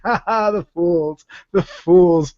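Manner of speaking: maniacal villain gloating, cackling; mad scientist. Retold or imitated. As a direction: gloat like a cackling evil genius. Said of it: "the cackling maniacally and going".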